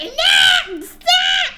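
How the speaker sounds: high, loud hammed-up yell; mock outrage. Streamer reading author's rant as a hammed-up tantrum. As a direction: shout the author's note like a tantrum.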